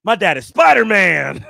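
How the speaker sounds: loud, high, amazed exclamation; awestruck five-year-old. Retold or imitated. Five-year-old seeing dad leap off balcony, awestruck. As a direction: cry it out in childlike wonder. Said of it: "in my five-year-old brain".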